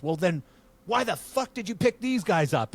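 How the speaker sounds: high, strained angry yell. Retold or imitated. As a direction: tear into her. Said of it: "he goes".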